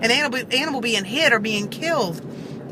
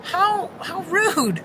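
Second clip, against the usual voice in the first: slow, drawn-out, much higher mock indignation; indignant; 8 semitones higher, much slower than usual. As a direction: stretch it out, scandalised. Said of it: "And I'm like".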